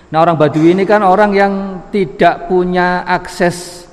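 normal talking voice

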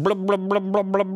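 high, loud gurgling blub sounds; talking underwater. Acted. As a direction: babble like a cat stuck underwater. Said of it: "who was trying to talk".

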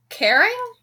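"Caring?" asked on a high rising pitch; puzzled question. Acted. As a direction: echo it back, puzzled and high. [name] puzzled, tilts his head.

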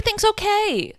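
high singsong placating; mock reassurance. Co-host mimicking the wife's evasive answer, mock-reassuring.

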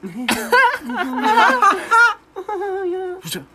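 loud, high, hammed-up cackle; fake laugh track. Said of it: "recording number one of the laugh track".

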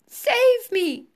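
high, loud squeal of fright; panicked cry. A narrator's reading. Wife rising helplessly into the air, panicked cry. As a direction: cry out to be rescued. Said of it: "she cried as she started going up".

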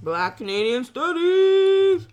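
high, loud and drawn out; excited shout. Real, not acted. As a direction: proudly call out the title. Correcting title excitedly.

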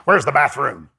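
put-on low old man's voice, lightly done; imitating Sumrall. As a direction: ask it as the elderly preacher. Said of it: "he talked like this".

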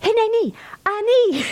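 Hineni and Ani both pitched high and bright; emphatic Hebrew. Shown as a demonstration. Teacher rereading the verse in Hebrew, emphatic and excited. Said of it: "So it is emphatic".

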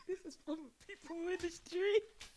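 very high, hammed-up parody voice. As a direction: send her up in a squeaky pitch. Cohost parodies the rapper.

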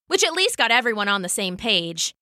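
plain reading voice

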